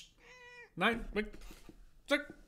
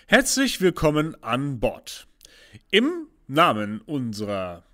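pilot announcement; 4 dB louder than usual. Acted. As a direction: announce like an airline captain. Captain's cabin announcement. (Flugkapitän-Durchsage)

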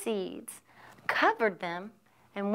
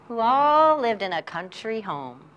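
plain reading voice

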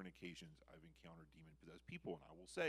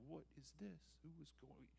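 higher, softer murmur of surprise; hushed bewilderment; 7 semitones higher, 9 dB quieter than usual. Acted. The people look around at each other, hushed and bewildered. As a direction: wonder aloud under your voice.

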